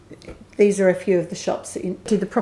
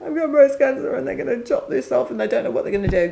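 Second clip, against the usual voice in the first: tearful rush; 4 semitones higher, faster than usual. Retold or imitated. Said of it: "I stopped sobbing eventually".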